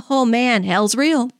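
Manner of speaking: ordinary delivery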